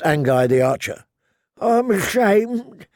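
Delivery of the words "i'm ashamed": put-on high, slow old man's voice. A narrator's reading. Old brother answers the archer about surviving, old man's voice. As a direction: admit it slowly in a high aged voice. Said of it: "the old man said".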